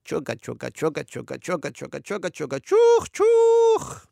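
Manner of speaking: chugging rhythm rising into two high loud hoots; playful choo-choo noises; train chant. A narrator's reading. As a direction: chant a steam train, whistle high at the end. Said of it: "humming to himself".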